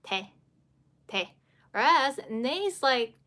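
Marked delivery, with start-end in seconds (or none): 0.0-1.3 te with the tongue hitting behind the upper teeth; 把“te”发成舌尖抵上齿背的平常t音; plain te. Shown as a demonstration. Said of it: "as for this te".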